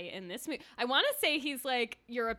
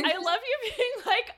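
high, loud, laughing tease; laughing delight; 11 semitones higher, 7 dB louder than usual. Real, not acted. Teasing a friend while laughing.

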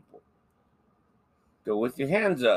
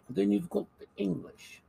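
high-pitched posh English accent; hammed-up posh English voice; 9 semitones higher, 4 dB quieter than usual. Shown as a demonstration. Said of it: "I can only do a posh English".

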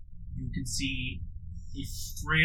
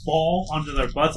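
normal talking voice

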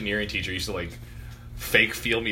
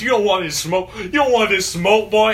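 higher, lightly hammed-up challenge; mock tough guy; 7 semitones higher, 4 dB louder than usual. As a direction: tease with a fake fighting boast. Teasing impression of friend as tough guy.

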